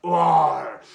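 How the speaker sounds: loud, drawn-out pirate "arr"; terrible pirate accent. Pretend. Host launching into pirate jokes, terrible pirate accent. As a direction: growl a hammy pirate roar. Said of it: "my terrible pirate accent".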